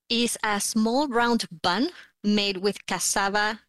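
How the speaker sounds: ordinary delivery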